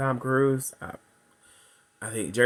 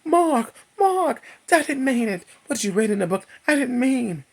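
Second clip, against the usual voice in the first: much higher, desperate calling; panicked Bridget; 12 semitones higher than usual. Retold or imitated. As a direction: call after him in a panic. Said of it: "She's like".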